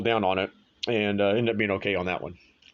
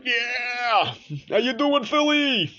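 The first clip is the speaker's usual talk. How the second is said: hammed-up Philly accent, much higher, yelled greeting; 3 dB louder, much slower than usual. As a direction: greet the crowd like a rowdy Philly fan in a Philly accent. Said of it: "I don't even know if that's a Philly accent".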